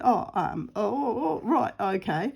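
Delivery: taken-aback oh, oh, oh; surprised husband. Retold or imitated. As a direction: caught off guard, halting. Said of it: "he said / a bit shocked".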